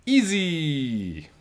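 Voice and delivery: high, buzzing fly saying Easy; buzzy fly voice. A narrator's reading. Fly telling the boy painting was easy, high and buzzy. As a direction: buzz it out like a cocky little fly.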